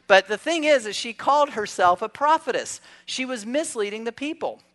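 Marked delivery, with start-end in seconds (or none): none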